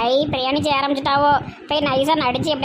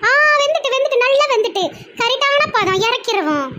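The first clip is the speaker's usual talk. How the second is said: Mumtaz voice; 9 semitones higher than usual. Acted. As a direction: pipe up in a high, proud voice.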